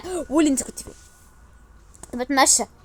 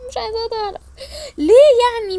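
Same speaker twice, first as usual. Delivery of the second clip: whiny cry; 9 semitones higher, 5 dB louder than usual. Acted. Upset over secret.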